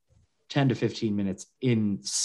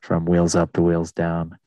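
lower, even captain's cabin announcement; airline pilot; 5 semitones lower than usual. Retold or imitated. Mocking how every pilot in America sounds, low pilot voice. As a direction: imitate a calm pilot on the PA. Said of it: "talk like".

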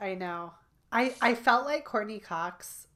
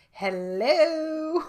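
higher, louder drawn-out hello; Jonathan Silverman impression; 8 semitones higher, 7 dB louder than usual. Imitating the actor's big hello to the friend, higher and louder. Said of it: "The way Jonathan Silverman says".

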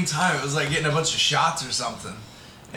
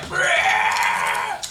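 imitated cockatoo screech; bird screech; 7 semitones lower, 4 dB louder than usual. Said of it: "this bird would just be like".

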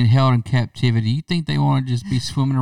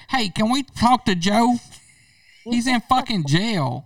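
high mock caller; 8 semitones higher than usual. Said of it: "We're like, call a".

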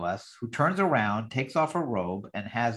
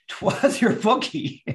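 laughing through the line; 9 semitones higher, 4 dB louder, slower than usual. Real, not acted. Giggles while quoting Myrna.

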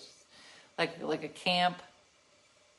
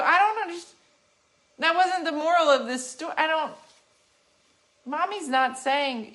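exasperated; 9 semitones higher, 5 dB louder than usual. Retold or imitated. Retold argument continues.

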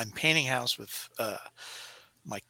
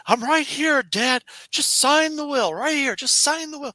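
high, loud wheedling son; scheming son voice; 13 semitones higher, 6 dB louder than usual. Retold or imitated. Robin Williams playing a greedy son coaxing his dad. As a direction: coax the old man into signing. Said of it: "one of them would go like".

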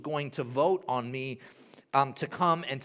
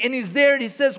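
ordinary delivery

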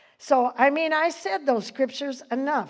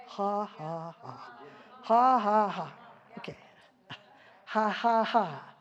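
spaced-out, joyless "ha, ha, ha"; half-hearted, deliberate mock laughter; forced laugh; 3 semitones lower, 4 dB quieter than usual. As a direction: fake a laugh while feeling down. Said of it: "have you ever been really down".

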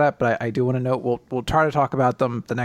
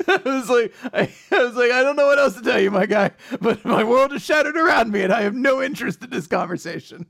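laughing; 10 semitones higher than usual. Retold or imitated. Laughing retelling of own reply.

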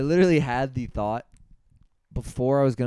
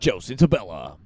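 louder campaign-ad voice; mock attack-ad announcer; 6 dB louder than usual. Co-host faking a district clerk race soundbite, announcer voice. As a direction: announce the name like a political smear ad.

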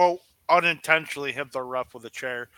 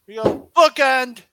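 wrestling commentator; 7 semitones higher, 7 dB louder than usual. Pretend. Calling the finish.

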